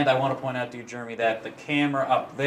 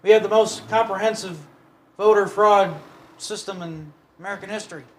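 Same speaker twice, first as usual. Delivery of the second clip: Biden quote; 7 semitones higher, 4 dB louder than usual. Retold or imitated. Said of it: "Remember when Joe Biden said".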